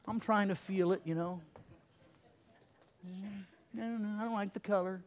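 high, soft mocking voice of a picky youngster; mocking whiny kid. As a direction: whine it like a spoiled kid who can't be pleased. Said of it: "we're surprised when they say".

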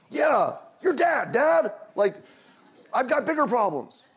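high, slow, exasperated mock son; irritated. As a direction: roll your eyes at an obvious question. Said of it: "He was a little bit irritated by the question".

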